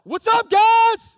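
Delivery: high, loud, drawn-out greeting; mock casual hello. Preacher parodying Jesus greeting the disciples, mock casual hello. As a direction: call out a hammed-up buddy greeting. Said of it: "it's not just a casual hello".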